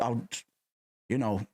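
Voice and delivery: normal talking voice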